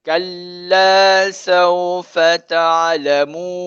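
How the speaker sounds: slow, drawn-out recitation; teacher modelling the verse for students, slow recitation; Quran recitation. Shown as a demonstration. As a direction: chant the verse slowly and evenly.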